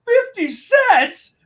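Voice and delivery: high, slow, stretched-out outrage at the tip; outraged disbelief. Retold or imitated. Driver outraged at the fifty cent tip, high and slow. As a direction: drag it out in disbelief. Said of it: "he's like".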